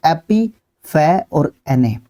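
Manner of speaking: एपी, फे और एने, slowly with pauses; slow syllable-by-syllable demo; three-syllable split. Shown as a demonstration. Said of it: "तीन syllable में पढ़ोगे तो इसको कुछ इस तरीके से".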